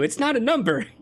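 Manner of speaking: ordinary delivery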